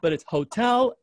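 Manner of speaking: ordinary delivery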